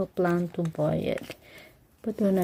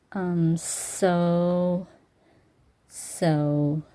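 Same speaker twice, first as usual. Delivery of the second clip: usual speaking voice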